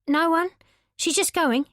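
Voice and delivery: high, hurried nervous brush-off; scared to set him off; nervous Lauren. Acted. Lauren nervously placating Dev.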